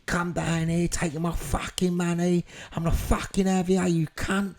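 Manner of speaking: retold angry shouting. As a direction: threaten them with a restrained retold scream. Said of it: "I'm screaming and shouting".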